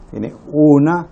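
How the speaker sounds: usual speaking voice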